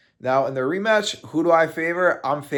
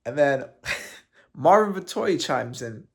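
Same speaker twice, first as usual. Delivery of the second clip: ordinary talk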